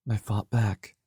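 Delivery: usual speaking voice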